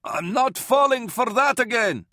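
high-pitched defiant shout; yell from behind a hatch. A narrator's reading. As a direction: call it out through a closed door. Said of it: "Varl yelled from behind the hatch".

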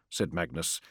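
usual speaking voice

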